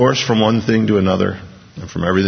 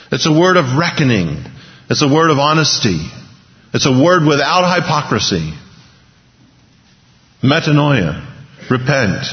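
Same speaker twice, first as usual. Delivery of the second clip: louder, higher, impassioned pulpit exhortation; forceful preaching; 6 semitones higher, 3 dB louder than usual. Real, not acted. Preacher urging the crowd to turn to God, forceful preaching. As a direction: drive each phrase home to the congregation.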